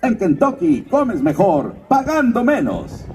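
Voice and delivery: slow announcer slogan read; mild commercial voice-over. Shown as a demonstration. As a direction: sell it like a radio spot. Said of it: "recuerdan esto que dice".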